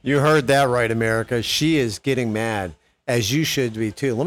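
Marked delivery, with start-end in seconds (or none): none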